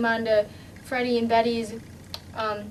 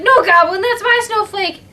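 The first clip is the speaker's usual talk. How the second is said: higher, louder angry yell at the goblin; defiant shout; 8 semitones higher, 9 dB louder than usual. Acted. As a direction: shout him off the snowflake. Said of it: "and was like".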